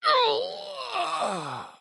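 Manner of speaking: high strangled pain cry. Acted. Companion badly hit, high pained cry.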